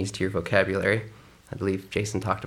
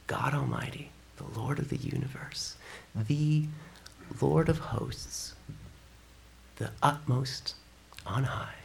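whispered reverence; awed whisper; 7 dB quieter than usual. Real, not acted. Pastor naming God's titles, awed whisper. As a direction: hush it with reverence.

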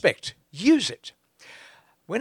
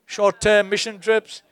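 ordinary talk, nothing marked

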